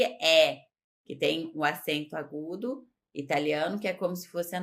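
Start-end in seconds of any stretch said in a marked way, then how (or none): 0.0-0.7 é said as an open eh; open vowel. Shown as a demonstration. Teacher contrasting it with perché, open vowel on é. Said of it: "Diferente de".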